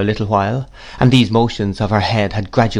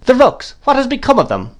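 high, insistent great-aunt voice; brusque aunt; 3 dB louder than usual. A narrator's reading. Miss Betsy presses about the rooks, insistent. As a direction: press for an answer, pitched up.

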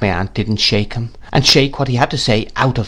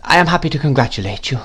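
meek, higher-pitched opening, then hurried politeness; 5 semitones higher than usual. A narrator's reading. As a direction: offer timid congratulations deferentially. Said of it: "in his meekest manner".

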